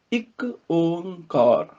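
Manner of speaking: slow ਇੱਕ, then long nasal ਓੰਕਾਰ; pronunciation demo. Shown as a demonstration. Teacher putting the parts together, slow nasal ਓੰਕਾਰ. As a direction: stretch each part of ਇੱਕ ਓੰਕਾਰ. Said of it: "ਇਹਦਾ ਉਚਾਰਨ ਦੇਖ ਲਓ".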